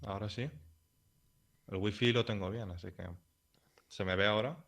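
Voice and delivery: faint, distant call audio; bad connection. Real, not acted. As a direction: voice fading on a weak line. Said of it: "te has quedado congelado".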